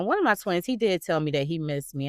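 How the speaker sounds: normal talking voice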